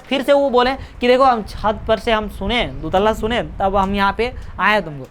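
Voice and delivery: ordinary talk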